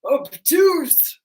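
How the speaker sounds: loud, lightly voiced big macho guy. Shown as a demonstration. Macho angle announcing its name as a memory trick, mock tough. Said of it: "they've got the lower voice".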